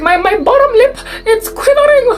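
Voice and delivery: high whiny quivering complaint; teary teacher voice. Retold or imitated. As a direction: mimic a teacher about to cry. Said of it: "she was like".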